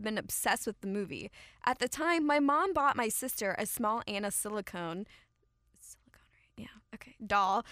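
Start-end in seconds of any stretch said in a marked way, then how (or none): 5.8-7.1 whispered check on a word; hushed aside. Real, not acted. Unsure of word, asks aside.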